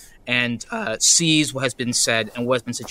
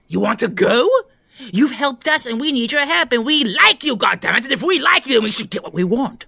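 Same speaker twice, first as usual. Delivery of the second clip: high, loud aggrieved yelling; indignant shouting; 11 semitones higher, 4 dB louder than usual. Acted. Stir fry yells at Ivan, hurt and demanding. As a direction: yell back hurt and defiant.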